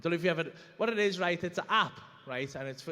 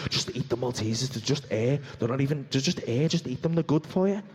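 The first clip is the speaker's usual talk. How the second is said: low coaxing tempter; low sly voice selling air as food; inner craving voice; 7 semitones lower, 4 dB louder than usual. Acted. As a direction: wheedle him into the sweets, low and persuasive. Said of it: "He's like".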